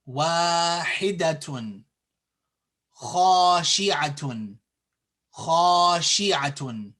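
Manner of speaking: slow demo, "haa", "waa", "khaa" held long; slow, emphatic model pronunciation with drawn-out a; long vowels. Shown as a demonstration. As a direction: stretch the long a in each of Ahanani, Wahidatun, Khashi'atun. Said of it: "we have to extend and lengthen it".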